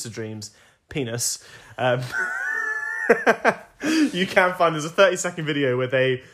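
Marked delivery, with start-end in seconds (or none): 2.0-4.1 high, loud burst of laughter; loud laughing shriek. Real, not acted. Telling her co-host about the hidden penis doodle, she bursts into a high, loud shriek of laughter.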